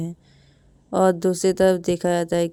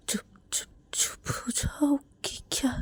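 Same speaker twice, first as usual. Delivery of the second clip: whisper; 7 semitones higher, 6 dB quieter than usual. Acted. Rahul hushing crying Ravi.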